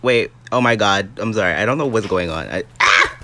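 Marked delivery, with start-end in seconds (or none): 2.8-3.2 sudden loud shriek of fright; short panicked scream; startled scream. Real, not acted. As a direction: yelp as if suddenly attacked. Attacked in game.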